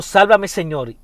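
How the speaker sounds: urgent quoted shout, moderately strong; retold cry. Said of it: "él gritó y dijo".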